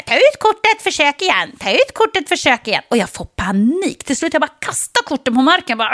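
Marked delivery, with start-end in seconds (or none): 0.0-2.8 higher, mechanical repeated prompt; be a shrieking card machine; mock machine voice. The parking machine rejecting her cards, mock robotic nagging. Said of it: "Den bara skriker så här".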